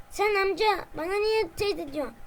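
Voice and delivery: ordinary talk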